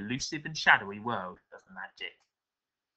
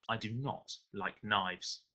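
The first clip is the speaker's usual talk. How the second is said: soft, slow, lowered finality; cool parting warning; 7 dB quieter, slower than usual. A narrator's reading. Silver leaving the beaten mugger behind, coolly quiet. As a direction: state it quietly and very slowly.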